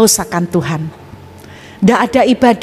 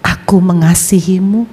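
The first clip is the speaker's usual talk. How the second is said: voice of God; 2 semitones lower than usual. Retold or imitated. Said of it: "Tuhan bilang".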